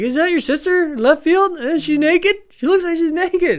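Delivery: put-on high squeaky taunting kid voice; high bratty taunt about the batter's sister; mock catcher taunt. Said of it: "the one part where he's like".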